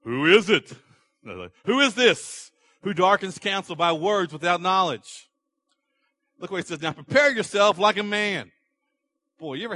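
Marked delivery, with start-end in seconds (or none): 0.0-5.3 a mild booming God; slow, louder divine challenge; God's voice. Acted. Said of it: "the Lord answered Job out of the whirlwind". 6.8-8.5 louder, higher commanding rebuke; a father-like God scolding; stern God. Acted.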